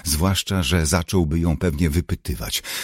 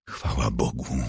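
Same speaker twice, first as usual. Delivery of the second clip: low, soft, understated groan; moaned relief; 6 semitones lower, 7 dB quieter than usual. A narrator's reading. Ginny finds her friend alive, softly moaning. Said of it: "jęknęła Ginny".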